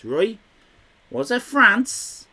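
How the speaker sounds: ordinary talk, nothing marked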